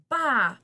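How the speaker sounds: loud, high bleating bah; sheep bleat. Acted. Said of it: "blatted the sheep".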